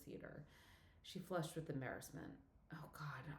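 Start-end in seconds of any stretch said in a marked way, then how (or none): 2.7-3.3 softer, whispered under the breath; a whispered, mortified oh God; embarrassed whisper. Acted. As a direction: hush it, mortified. Embarrassed after realizing mistake.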